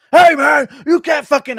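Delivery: the rival fighter shouting back mid-taunt; opponent yelling back. Acted. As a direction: blurt it out, furious and loud.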